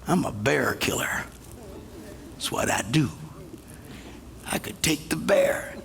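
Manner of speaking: low, soft musing to himself; understated quiet boast; quiet self-talk. Retold or imitated. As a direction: savour it privately. David musing confidently.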